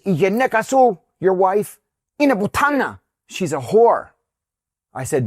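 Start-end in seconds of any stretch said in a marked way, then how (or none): 0.1-4.1 high, punchy retold outburst; all excited. Excited driver insulting the wife to her husband, worked up. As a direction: fling the insult out, pitch jumping. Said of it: "he gets all excited".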